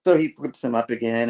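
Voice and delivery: ordinary delivery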